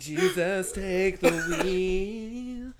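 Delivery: high, loud, very drawn-out laughing cry. Real, not acted. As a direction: stretch it out through laughter. Laughing exclamation.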